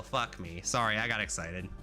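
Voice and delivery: ordinary talk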